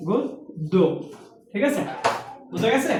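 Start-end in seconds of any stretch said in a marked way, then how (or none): 0.1-0.7 গদ্য said "goddo", soft and rounded; গদ্য with o at the start, much quieter; গো sound in গদ্য. Shown as a demonstration. Teacher quietly modelling the word for students, গদ্য as "goddo". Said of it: "লেখা যায় গ করে গো".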